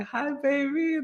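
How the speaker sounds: sing-song the wife's fond hello; sweet wife greeting. Retold or imitated. Wife greeting him in hospital, mock sugary high hello. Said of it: "and she's like".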